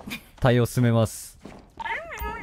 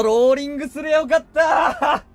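frustrated shout; 12 semitones higher than usual. Real, not acted. Frustrated after dying in game.

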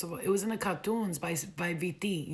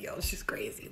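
whispered aside; 8 semitones lower, 4 dB quieter than usual. Retold or imitated. Soldier whispering about her.

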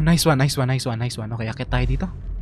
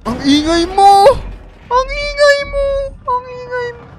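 high, loud, slow scolding; scolding shout; 7 dB louder, slower than usual. Real, not acted. Gamer scolding her clumsy character for being noisy, shouting.

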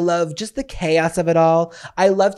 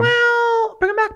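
high squeaky voice; 4 dB louder, slower than usual. Pretend. High silly voice to partner.

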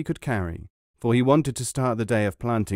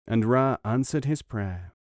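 plain reading voice

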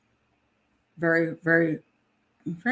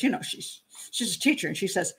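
ordinary talk, nothing marked

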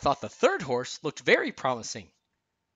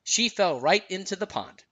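plain reading voice

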